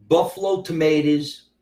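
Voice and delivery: usual speaking voice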